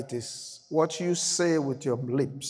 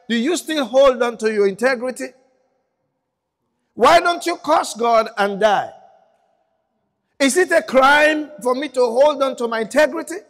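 wife's challenge; 7 semitones higher, 6 dB louder than usual. Retold or imitated. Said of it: "The wife said".

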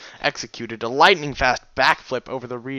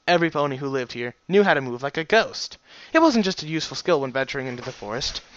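ordinary delivery, nothing marked

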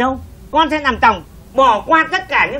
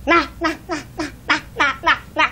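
laughing coax; 4 semitones higher, 4 dB quieter, much faster than usual. Acted. Laughing coaxing.

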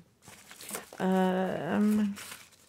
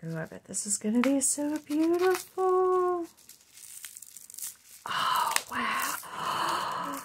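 much higher, gushing awe; high-pitched oohing wonder; awed delight; 9 semitones higher than usual. Real, not acted. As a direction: gush over something beautiful. Awe at stones.

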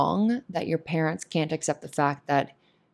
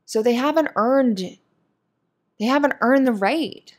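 higher, louder, indignant push through every point; heated rant; 5 semitones higher, 4 dB louder than usual. Real, not acted. Venting to a closeted viewer about parents, in rising indignation. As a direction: lay into the parents, building in outrage.

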